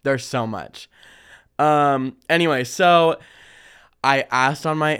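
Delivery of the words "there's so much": usual speaking voice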